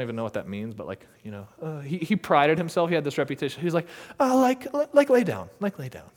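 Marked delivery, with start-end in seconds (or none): 4.2-6.1 lightly voiced casual guy. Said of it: "prided himself on being, like, a chill person".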